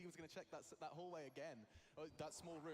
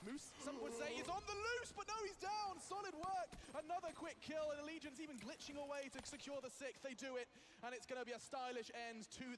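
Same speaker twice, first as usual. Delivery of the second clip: much higher and louder climax call; hype play-by-play; 10 semitones higher, 7 dB louder than usual. Real, not acted. Caster calling the map win for viewers, loud excited. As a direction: build the round's end to a shout.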